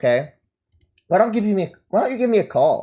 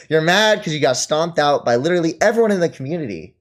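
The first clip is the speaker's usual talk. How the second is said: normal talking voice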